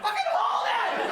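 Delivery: high, loud, slow shout; mock baby yell. Newborn daughter demanding to be held, high loud mock shout. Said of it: "my daughter's like".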